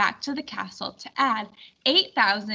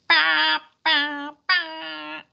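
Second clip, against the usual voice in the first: sung trumpet call, raised; mock trumpet fanfare; 5 semitones higher, much slower than usual. Narrator imitating the distant trumpet, sung fanfare. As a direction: toot it like a herald's trumpet.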